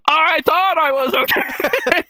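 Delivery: high, drawn-out wail; hammed-up distressed whine; mock panicked buddy. Acted panicked buddy hiding.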